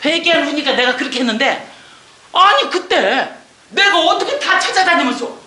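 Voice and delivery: ordinary delivery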